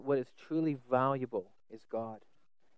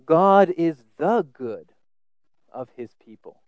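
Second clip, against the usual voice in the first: slow, loud "God is the good"; stress on good; 2 semitones higher, 11 dB louder than usual. Shown as a demonstration. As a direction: weigh "the good" slowly. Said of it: "you could almost say it like this".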